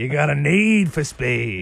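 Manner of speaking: drawn-out teasing line, x0.4 slow; slow joke voice. Pretend. Co-host teasing a speeding caller, slow drawn-out voice. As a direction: stretch it out for a joke.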